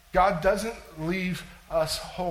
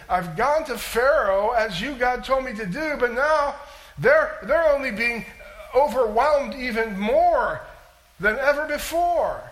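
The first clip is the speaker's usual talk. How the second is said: loud complaint; 5 semitones higher, 6 dB louder than usual. Acted. Preacher paraphrases Moses complaining.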